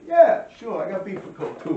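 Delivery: loud, high, challenging yeah; defiant retort. Retold or imitated. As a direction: snap back as if ready to fight. Retelling his answer to a challenge.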